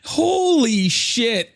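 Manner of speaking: high, slow drawn-out exclamation; stunned. Real, not acted. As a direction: stretch it out in disbelief. Still laughing in disbelief.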